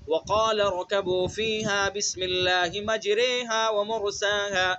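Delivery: melodic tajweed recitation of the verse; Quran recitation. Acted. Teacher reciting the verse for students. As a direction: recite the verse in measured chant.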